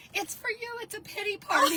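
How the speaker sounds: spring the surprise on her; excited reveal. Retold or imitated. Coworkers unveiling her pity party, high and excited.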